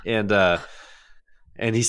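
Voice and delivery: ordinary delivery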